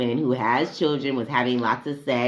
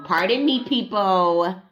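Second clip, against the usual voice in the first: polite excuse; 5 semitones higher than usual. Real, not acted. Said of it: "but I was on a tangent".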